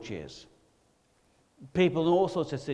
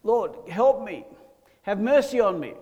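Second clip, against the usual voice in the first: acted plea, raised in pitch and volume; louder, higher imitated cry for help; 6 semitones higher, 6 dB louder than usual. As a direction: call out for mercy as the blind beggar. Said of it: "blind Bartimaeus cried out".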